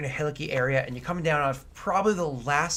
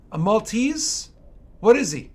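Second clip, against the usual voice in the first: raised, high questions across a distance; calling out; 8 semitones higher, 8 dB louder than usual. Acted. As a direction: holler guesses after a departing traveler. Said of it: "he calls out to you".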